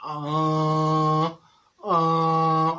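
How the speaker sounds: long, level moan in imitation; drawn-out groan. Friend sits in the corner going, long mock groan. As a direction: moan slowly as if suffering, hammed up. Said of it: "looking at the window going".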